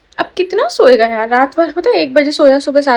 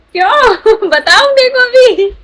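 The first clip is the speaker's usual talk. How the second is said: high, louder giggling questions; 9 semitones higher, 5 dB louder, slower than usual. Real, not acted. Laughing baby talk to infant.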